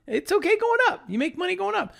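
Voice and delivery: high sing-song reassurance. Pretend. Reassuring traders, high and sing-song. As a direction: sing it up like a cheery jingle.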